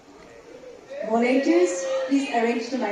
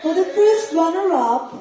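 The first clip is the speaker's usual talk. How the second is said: pageant announcer; 6 semitones higher, 5 dB louder than usual. Acted. Announces first runner-up.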